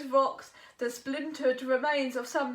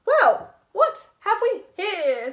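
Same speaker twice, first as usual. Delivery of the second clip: loud, sly toothy crocodile; 5 semitones higher, 12 dB louder, slower than usual. Acted. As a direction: relish your surprise catch. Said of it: "said the stunned crocodile".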